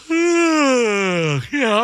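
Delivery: long drawn-out yawning yeah; mock yawn. Acted yawn.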